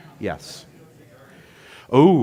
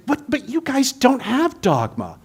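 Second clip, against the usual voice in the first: high, startled "what?" then disbelief; a priest caught off guard by the claim; incredulous priest; 12 semitones higher than usual. Retold or imitated. Priest baffled by the UU's answer, high incredulous. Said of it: "priest is like".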